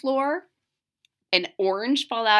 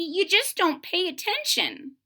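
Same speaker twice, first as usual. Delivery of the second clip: little witch voice; 7 semitones higher than usual. Acted. Maggie the little witch protests to her family, high and indignant. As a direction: insist like an indignant little girl. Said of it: "said Maggie".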